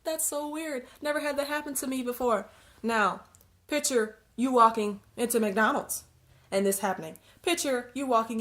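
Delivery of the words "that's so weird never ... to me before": high, forced mock laughter; an awkward polite laugh; fake laugh. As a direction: laugh it off, higher and put-on. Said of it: "You might even be like".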